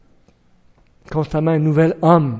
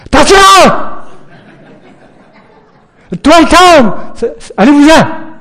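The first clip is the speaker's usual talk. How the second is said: loud, high panicked yell of warning; alarmed shout; 11 semitones higher, 10 dB louder than usual. Shown as a demonstration. Warning a crowd the roof is collapsing, panicked shout. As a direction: yell at people to get out from under the roof. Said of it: "qu'est-ce que je vais faire?".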